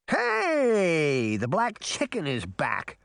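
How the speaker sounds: high, drawn-out opening, lightly voiced mischief-maker; Puck's jeering call. A narrator's reading. Puck announces the crow's return, teasing call. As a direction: call out high, then drop toward normal.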